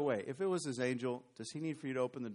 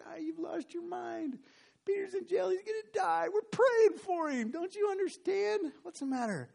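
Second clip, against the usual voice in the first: exasperated shouting; 5 dB louder than usual. Acted. Praying crowd yelling at the girl, high and exasperated. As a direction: shout her down in disbelief.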